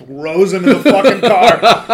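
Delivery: loud, high, laughing storytelling; real amused shout through laughter. Real, not acted. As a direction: burst into laughter while finishing.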